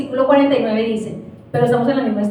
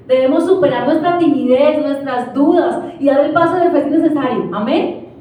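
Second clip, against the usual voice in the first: usual speaking voice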